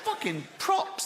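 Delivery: high, slow mock admiration; hammed-up awe; impressed judge. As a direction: draw it out as a high cheer of respect.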